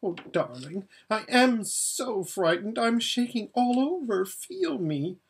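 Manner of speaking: high, fluttering voice of a pampered, scared lady; frightened Lasaraleen. Acted. Lasaraleen begs Aravis to feel her shaking, high and scared.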